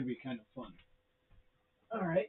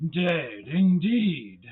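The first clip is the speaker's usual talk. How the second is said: dramatic loud ending; 4 semitones higher, 10 dB louder, much slower than usual. Acted. Climax of creepy poem.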